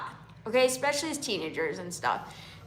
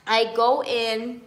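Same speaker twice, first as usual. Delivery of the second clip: ordinary talk, nothing marked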